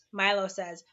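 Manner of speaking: ordinary delivery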